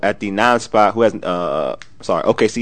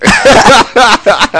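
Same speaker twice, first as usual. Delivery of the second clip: loud, high-pitched laugh; real high belly laugh; burst of laughter; 13 semitones higher, 9 dB louder than usual. Real, not acted. Bursts out at suggestive wording.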